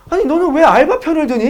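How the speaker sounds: high-pitched mock maternal scolding; angry mother. Mother scolding son for siding with part-timer, angry. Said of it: "엄마가 막 존나 화를 내".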